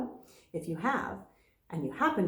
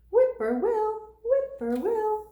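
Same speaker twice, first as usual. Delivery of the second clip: much higher, louder bird call; high, lilting whippoorwill; 12 semitones higher, 5 dB louder than usual. A narrator's reading. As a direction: sing it like a whippoorwill. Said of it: "Whippoorwill has its favorite trill".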